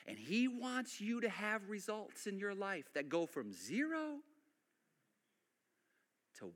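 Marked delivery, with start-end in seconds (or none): none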